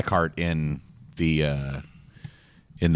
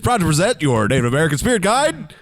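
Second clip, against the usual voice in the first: announcer voice; 8 semitones higher than usual. Pretend. Announcer intro continues.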